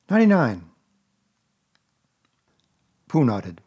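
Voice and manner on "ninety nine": put-on higher, brighter boy's voice asking; a small boy catching on; boyish Christopher Robin. A narrator's reading.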